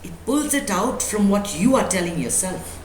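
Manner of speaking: ordinary talk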